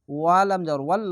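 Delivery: ordinary delivery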